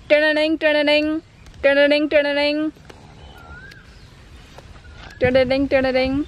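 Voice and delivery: sing-song ringtone sounds; phone ringing. Pretend.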